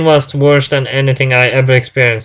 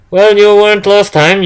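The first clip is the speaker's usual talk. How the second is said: reproachful; 8 semitones higher, 4 dB louder than usual. Acted. Boss scolding late narrator.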